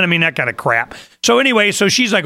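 normal talking voice

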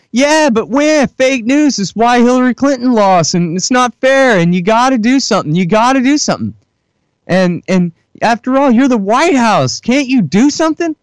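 high, pleading whine; whiny reporter. Retold or imitated. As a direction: nag like a child who won't let go. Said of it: "to stop crying".

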